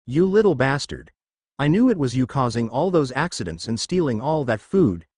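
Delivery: ordinary delivery, nothing marked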